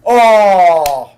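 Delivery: long, high, loud "oh" falling away; loud groaning shout. Real, not acted. Fan watching a brilliant block, loud drawn-out cry. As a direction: cry out at a near miss.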